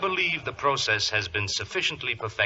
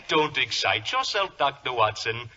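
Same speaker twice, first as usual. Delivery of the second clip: usual speaking voice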